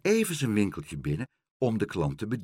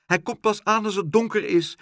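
high, loud agitated protest; worked-up high voice; shouting; 12 semitones higher, 5 dB louder than usual. A narrator's reading. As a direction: keep crying out, pitched high. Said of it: "schreeuwde Dolly".

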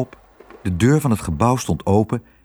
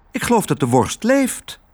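high voice rising at the end; astonished girl's call; 11 semitones higher than usual. Acted. Girl seeing the bag wriggle, high astonished call. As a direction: report it in high, rising amazement. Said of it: "riep Otje".

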